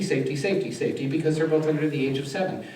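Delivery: normal talking voice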